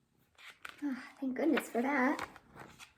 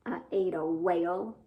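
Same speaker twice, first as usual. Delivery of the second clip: low worm voice; 7 semitones lower than usual. Acted. Voicing Herman the Worm.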